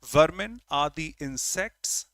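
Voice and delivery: ordinary talk, nothing marked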